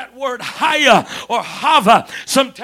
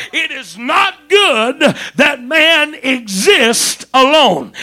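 loud, higher, slowed climax; full-force preaching; 3 semitones higher, 5 dB louder than usual. Acted. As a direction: preach it to the back row.

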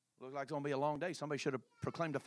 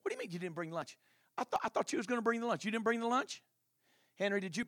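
alarmed questions; 6 semitones higher, 3 dB louder than usual. Acted. Acted panic over missing lunch.

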